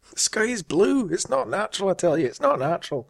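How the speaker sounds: high, hammed-up Scottish accent. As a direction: grumble it like a dour Scot. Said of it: "practicing my Scottish accent just for you".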